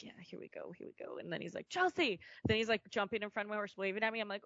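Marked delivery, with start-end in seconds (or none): 0.0-1.2 soft whisper to herself; hushed here we go; whispered self pep-talk. Retold or imitated. Self-talk while listening to music. 1.7-2.2 louder, higher call for attention; calling out name. Retold or imitated. Trainer calling her name.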